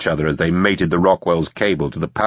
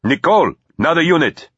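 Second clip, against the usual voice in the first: raised, high urgent shout; urgent call; 10 semitones higher, 3 dB louder than usual. A narrator's reading. Calling to Nicole for another unit, urgent. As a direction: call out an order in alarm.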